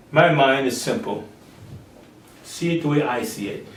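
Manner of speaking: the Father speaking calmly and low; voice of God. Acted.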